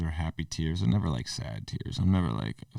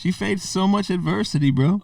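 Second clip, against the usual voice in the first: pitched way up in hammed-up admiration; mock emotional gush; 12 semitones higher, 4 dB louder than usual. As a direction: gush like a moved fan, half joking. Jokingly gushing about Tina.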